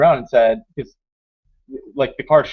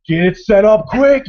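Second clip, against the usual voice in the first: loud, high, drawn-out command; retold urgent order; 7 semitones higher, 7 dB louder, slower than usual. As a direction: act out hurrying the team along. Retold order as zombies close in.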